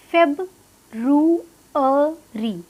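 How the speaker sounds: February very slowly, feb - roo - uh - ree; syllable by syllable, pausing inside February; broken into parts. Shown as a demonstration. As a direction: spell out February by sound for learners. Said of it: "तीन parts में इसको divide करना है".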